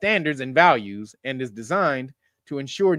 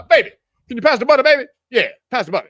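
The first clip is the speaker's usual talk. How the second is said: fast, louder casual husband; switching to own voice; 5 semitones higher, 8 dB louder, much faster than usual. Pretend. Comedian at the dinner table correcting himself, fast casual request. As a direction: snap back to your usual self quickly. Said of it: "at the table".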